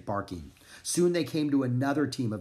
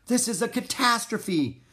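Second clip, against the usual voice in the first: high, raised cry of disaster; shouting; 10 semitones higher than usual. A narrator's reading. Gunner despairing over lost medicine, shouting. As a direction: exclaim in dismay. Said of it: "shouted Gunner".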